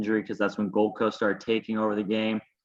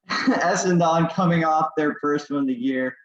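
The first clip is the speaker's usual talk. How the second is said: laughing; 6 semitones higher, 5 dB louder than usual. Real, not acted. Joking team name hype.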